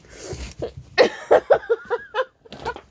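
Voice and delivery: loud, high burst of laughter; uncontrolled high-pitched laughing fit; big real laugh. Real, not acted. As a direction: cackle long and high. Bursts out laughing at own rant.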